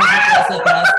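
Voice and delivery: high, loud laughing cry of atrás; laughing shout. Retold or imitated. Watching the parents fall behind, laughing high shout.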